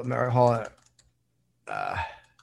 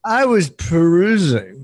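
higher, pointed "perusing"; playfully affected; 4 semitones higher than usual. Acted. Host answering co-host's teasing about perusing, playfully affected. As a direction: lean grandly on "perusing", owning the word.

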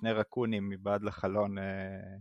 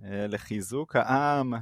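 ordinary talk

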